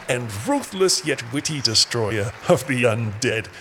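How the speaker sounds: ordinary talk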